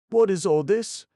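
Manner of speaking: loud high alarmed cry; involuntary cry. Acted. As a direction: blurt it out in alarm. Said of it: "cried the girl involuntarily".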